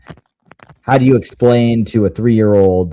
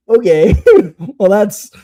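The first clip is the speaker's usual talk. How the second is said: higher-pitched, breaking into laughter; laughing reply; 9 semitones higher, 4 dB louder than usual. Retold or imitated. Dad gives up questioning his daughter, laughing as he retells it. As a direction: laugh through the retold reply.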